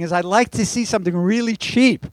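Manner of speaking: lightly voiced stingy husband; Irving's voice. Pastor tells a joke, Irving asking the clerk, lightly voiced. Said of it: "said Irving".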